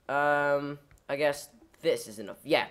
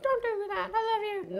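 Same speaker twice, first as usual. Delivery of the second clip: very high, whimpering plea; mock tearful pleading. Creator begging a leaving viewer, mock crying. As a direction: beg in a squeaky fake cry.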